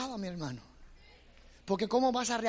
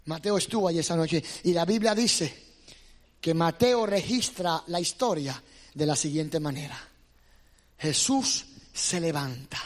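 slow solemn preaching; 6 dB louder than usual. Acted. Preacher drops back to slow.

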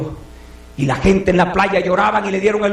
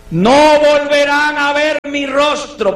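slow, raised solemn farewell line; Pablo's farewell; 7 semitones higher, 4 dB louder, slower than usual. Acted. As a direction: say it slowly like a final goodbye. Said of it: "y les dijo unas palabras". (despedida solemne y lenta)

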